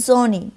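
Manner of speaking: জনে with a buzzing z at the start; German S as Z. Shown as a demonstration. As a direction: say জনে, S sounding like Z. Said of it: "ওরা অনেকটা জেড এর মতো করে". (জেড-এর মতো এস দিয়ে জনে)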